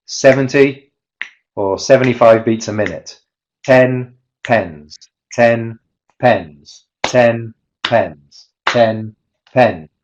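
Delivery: chanting stress-timed phrases to a slow beat; rhythm drill. Shown as a demonstration. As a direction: beat out each stressed syllable evenly. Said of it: "I'm just gonna do it with you".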